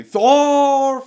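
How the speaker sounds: high, drawn-out scream of a name; hammed-up battle scream; Thorkill. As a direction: bellow it at full force, stretching it out. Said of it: "we got Thorkill screaming".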